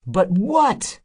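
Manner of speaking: high, loud outburst; cried out. A narrator's reading. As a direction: burst out at her. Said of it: "the Druin cried".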